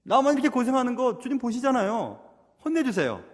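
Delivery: indignant tattling to the Lord; aggrieved appeal. Acted. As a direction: plead like a put-upon older sister. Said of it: "주님께 얘기하는 겁니다".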